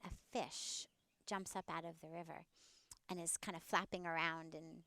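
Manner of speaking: ordinary delivery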